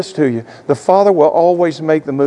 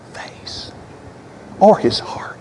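hushed; 4 semitones higher, 7 dB quieter, slower than usual. Real, not acted. Preacher hushing the congregation on the father's heart. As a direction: whisper the last words. Solemn point about the father.

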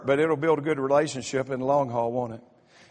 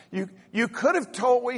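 upset wife; 7 semitones higher than usual. Retold or imitated. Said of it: "She said".

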